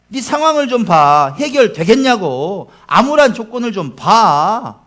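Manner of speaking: loud, slow, hammering taunts; harsh accuser. Acted. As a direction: press the despair on them slowly. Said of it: "이런 바람이죠".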